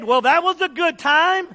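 a hint of a gushing worshiper; chatty churchgoer. Leaving churchgoers praising the service, light mock gush. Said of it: "they'd have said".

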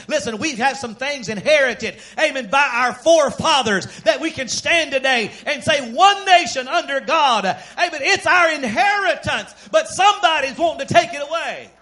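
ringing pulpit delivery; full-force preaching. Real, not acted.

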